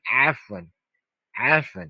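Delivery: आफ़वन with a guttural ain at the start; Arabic ain sound. Shown as a demonstration. Teacher showing students आफ़वन with the ain. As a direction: say आफ़वन from the throat, Arabic style. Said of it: "Arabic accent कैसे catch किया जाए".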